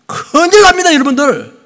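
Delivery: high, loud alarmed warning; warning cry. Real, not acted. Pastor warning the congregation, loud and high. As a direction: cry out the danger.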